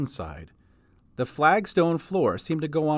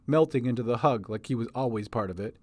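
ordinary delivery

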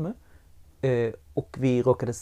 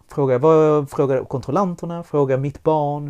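ordinary talk, nothing marked

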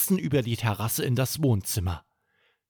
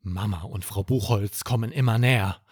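ordinary delivery

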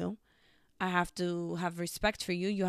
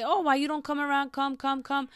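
higher, louder mimicked begging; insistent mock coaxing, pitched up; mock pleading; 6 semitones higher, 4 dB louder than usual. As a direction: imitate someone pestering for a visit. Mimicking someone begging others to visit.

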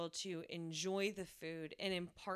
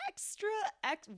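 voice cracking; 13 semitones higher, 3 dB louder than usual. Real, not acted. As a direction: voice cracks mid-word. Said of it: "my voice just cracked".

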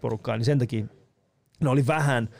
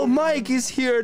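high, slow mock call of Yo, Mike is here; retold shout, hammed up; 11 semitones higher, much slower than usual. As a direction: call it out slowly and high, as if across a room. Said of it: "kun mä olin huutanut".

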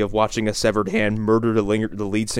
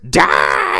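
sudden gasping war cry; hammed-up stoner girl attacking; mock attack cry. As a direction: lunge with a cry. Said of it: "She's like".

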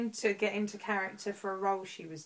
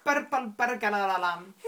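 laughing, rolled-R Scottish attempt; hammy Scottish with extra syllables; bad Scottish accent; 4 dB louder than usual. Shown as a demonstration. Host retrying for a teasing friend, laughing bad Scottish accent. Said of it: "that's my Scottish impression".

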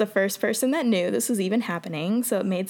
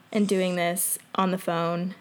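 ordinary talk, nothing marked

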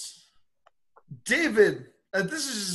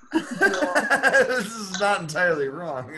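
laughing; 4 dB louder, slower than usual. Real, not acted. Laughing at stripper name joke.

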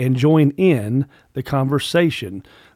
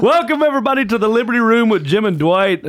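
hammed-up show host welcome; big, laughing radio host intro; announcer voice; 8 semitones higher, faster than usual.

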